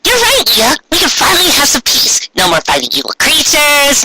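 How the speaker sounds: put-on high, sweet girlish voice; a light schoolgirl chirping with relief; cheerful magical girl. Schoolgirl to her friend, relieved at peace: high girlish voice.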